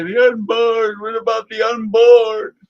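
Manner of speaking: high, loud pleading mock cry; loud mock plea for the unborn; mocking old zealot. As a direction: ham up the pious old extremist. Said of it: "he used to say".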